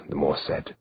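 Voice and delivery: plain reading voice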